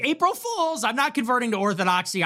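high, sing-out punchline; gleeful mock "gotcha" shout at normal volume; mock-excited reveal. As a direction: leap up high as if springing a prank. Springs the punchline of a joke.

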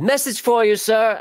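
high, loud mock Monty Python voice; high-pitched comic quote; silly movie quote voice. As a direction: squeak out the line like a comic knight. Quoting movie line in silly voice.

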